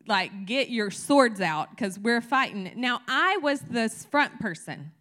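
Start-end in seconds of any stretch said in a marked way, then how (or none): 0.0-2.7 loud battle cry to the prayer team, slowed at the end; calling friends to arms, loud and fired up; rallying call. Retold or imitated. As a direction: rouse the troops to fight. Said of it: "I'm like".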